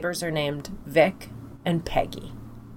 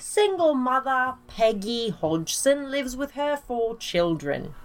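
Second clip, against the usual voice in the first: slow, hammed-up British narration; put-on London accent; 5 semitones higher, slower than usual. Telling the Enfield story, mock London accent. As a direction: overdo a stage English accent. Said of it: "Are you doing this with an accent?".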